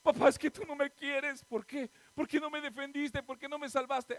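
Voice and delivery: voz aguda de niña que reprocha; hija dolida reclamando. Acted. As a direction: reclama a papá como hija sentida. Reclamo actuado de una hija. (imitación de hija quejosa, tono alto)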